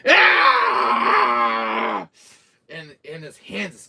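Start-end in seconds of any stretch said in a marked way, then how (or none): 0.1-2.1 loud pained cry; hammed-up agonized yell; pained scream. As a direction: scream like your hand was just cut off. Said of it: "And he's like".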